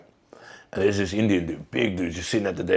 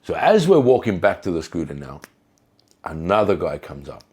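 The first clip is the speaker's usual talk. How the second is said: ordinary talk, nothing marked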